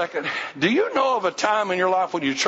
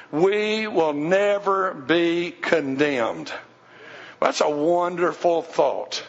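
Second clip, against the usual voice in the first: usual speaking voice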